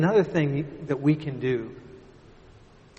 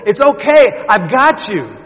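calling, reassuring father; 8 semitones higher, 15 dB louder than usual. Retold or imitated. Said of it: "He said".

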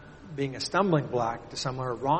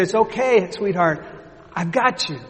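reassuring father; 5 semitones higher, 6 dB louder than usual. Retold or imitated. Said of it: "He said".

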